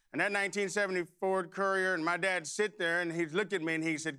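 ordinary talk, nothing marked

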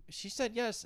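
mimicked announcement. Comic recalling the proposal interruption, high-pitched mimicry. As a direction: echo the shout way up high without the volume. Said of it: "all I hear in the back is".

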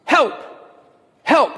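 high-pitched mock cry for help; short, high mock yell; retold yell for help. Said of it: "the sound of someone yelling".

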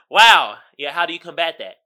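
usual speaking voice

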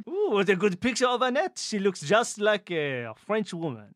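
delighted stage-French compliment; mock French accent. Player praising a teammate's drawing, put-on French accent. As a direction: admire it like a comic Frenchman. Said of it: "speak like a, a Frenchman".

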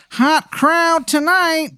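very high, slow put-on voice; Michael Park. Door guy greeting the arriving comic, high drawn-out voice. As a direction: draw it out in a silly high-pitched voice. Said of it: "the door guy, Michael Park".

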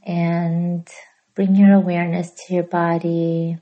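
hushed yoga nidra guide, long pauses; soft, unhurried relaxation voice; guided meditation. Real, not acted.

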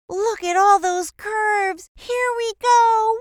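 loud, high thrill-ride cry; excited shouting. Acted. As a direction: shout it out as the coaster drops. Starting a roller coaster ride.

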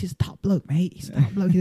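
light Australian twang on top bloke; Australian accent. Retold or imitated. Quoting a mate in an Australian accent. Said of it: "he also called people top blokes".

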